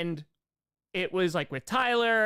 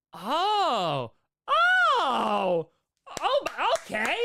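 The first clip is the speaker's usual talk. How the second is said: surprised oh; 8 semitones higher, 4 dB louder, much slower than usual. Real, not acted. Reacting to stream length.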